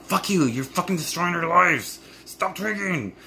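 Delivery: high, loud mock yell; angry outburst. Yelling at her addicted boyfriend, angry and high. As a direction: lash out at him.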